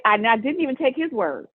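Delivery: ordinary delivery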